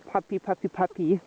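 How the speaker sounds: ordinary talk, nothing marked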